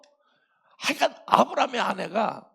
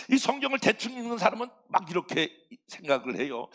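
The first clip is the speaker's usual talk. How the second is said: normal talking voice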